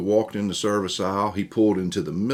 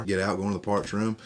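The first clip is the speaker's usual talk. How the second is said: ordinary delivery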